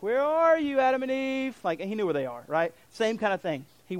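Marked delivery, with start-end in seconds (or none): 0.0-1.6 higher calling voice; God calling to hidden Adam and Eve, raised pitch. Acted. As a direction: call out to someone hiding.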